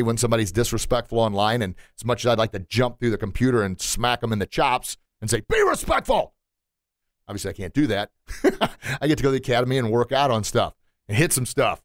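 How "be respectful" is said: high, strained mock yell; mock angry demand. Host imagining confronting a rude commenter, high-pitched indignant outburst. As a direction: snap it out high at an online troll. Imagined yelling through the computer.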